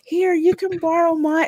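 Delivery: put-on high-pitched little-girl voice. Girl lending Sonic her shoes, high little-girl voice. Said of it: "like, she's like".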